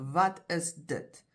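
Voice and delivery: "Wat is dit" slowly, every word distinct; very clear enunciation. Shown as a demonstration. Teacher repeats it while pointing to the object, very clearly. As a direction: separate each word of "Wat is dit", unhurried. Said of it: "you'll say very clearly".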